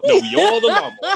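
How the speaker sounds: way up high, laughing through the correction; high-pitched laughing correction; squeaky high coach correction. Retold or imitated. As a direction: squeal the line in a cracking falsetto. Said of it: "And he says".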